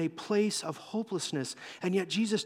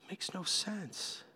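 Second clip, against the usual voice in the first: very slow, soft repeat; quiet disbelief; 9 dB quieter, much slower than usual. Acted. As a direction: let it sink in, baffled and subdued. Acted Peter repeats it to himself.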